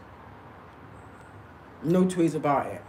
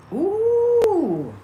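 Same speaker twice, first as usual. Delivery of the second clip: high ooh; 13 semitones higher, 5 dB louder than usual. Real, not acted. Relieved exclamation between glad lines.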